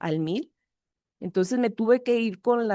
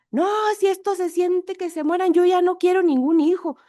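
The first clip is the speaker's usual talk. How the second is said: grieving mother; 9 semitones higher, 4 dB louder than usual. Retold or imitated. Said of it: "pues lloraba mucho".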